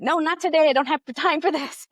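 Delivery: retelling her younger self refusing the doctor; arguing mom. Retold or imitated. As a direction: brush them off, impatient and quick. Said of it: "this mom who was arguing".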